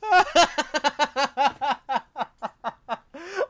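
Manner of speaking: a loud, high cackle at her; loud mocking laugh. Real, not acted. Reacting to Casey's clip with a loud scornful laugh.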